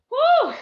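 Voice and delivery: very high, loud whoop; whooped "woo". Real, not acted. Coach cheering on the team through the burn, high loud whoop.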